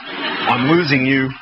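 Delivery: slow laughing refrain. Acted. Poet to crowd, laughing through the refrain.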